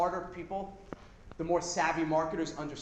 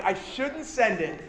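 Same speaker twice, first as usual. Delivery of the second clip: mock evil; 3 semitones higher than usual. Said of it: "I was so evil".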